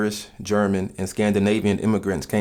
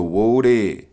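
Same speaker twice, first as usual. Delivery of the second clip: a light New Orleans drawl on whoa there; New Orleans accent; 6 dB louder than usual. Shown as a demonstration. A sample New Orleans greeting, light New Orleans accent. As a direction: say it like a New Orleans local, louder.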